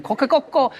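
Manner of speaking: high mock crow of kokekoko; playful rooster imitation; Japanese rooster crow.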